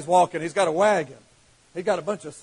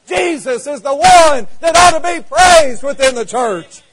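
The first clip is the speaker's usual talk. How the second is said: rising to a full-force preacher's shout; preaching; 5 semitones higher, 6 dB louder than usual. Real, not acted. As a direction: lift it up like a revival preacher.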